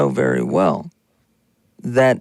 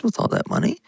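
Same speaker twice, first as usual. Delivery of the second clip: normal talking voice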